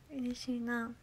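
slow laughing whisper. Real, not acted. Responding to a kind viewer in a slow whisper. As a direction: breathe it happily.

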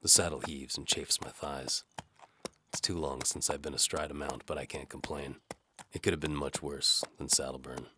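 ordinary delivery

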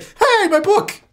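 high Sim voice. Pretend. Voicing a Sim's reaction.

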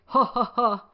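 jolly read-out laugh; grandpa's laugh. A narrator's reading. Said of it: "laughs Grandpa Pig".

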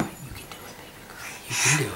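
soft whispered encouragement; whispering. Real, not acted.

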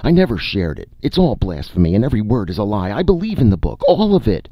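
grovel, voice climbing; frantic pleading. A narrator's reading. Needlesmith begging the court for mercy, climbing high and loud. Seth frantically renouncing heresy.

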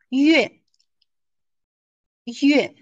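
月 said loud and falling sharply; sharp fourth-tone drop on 月; falling tone yue. Shown as a demonstration. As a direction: Mandarin 月, fourth tone.

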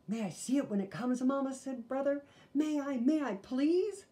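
put-on eager pleading child; begging boy. A narrator's reading. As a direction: beg Mama eagerly.

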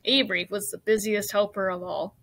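ordinary delivery

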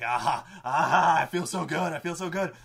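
loud, gloating laugh into elated cries; acted euphoric villain; villain's ecstasy. Villain revels in his healing.

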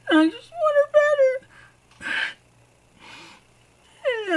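crying, voice cracking high and loud; real sobbing through the words; breaking into tears. Real, not acted. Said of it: "I was trying so hard not to cry".

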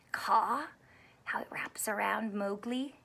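soft whispered aside; whispered Jungle Book aside. Acted. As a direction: hush it like a secret.

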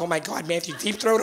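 low, soft mock-parent shock; hushed dismay. Canadian parent reacts to the child's question, hushed. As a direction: drop your voice in mild horror.

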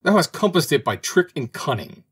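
high-pitched, indignant accusation; angry accusation. A narrator's reading. Birds accusing the little bird of trickery, high and angry. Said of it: "cried the birds angrily".

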